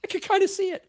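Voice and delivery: squeaky high concession; high-pitched grudging voice; high reluctant admission. Retold or imitated. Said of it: "that little sliver and say".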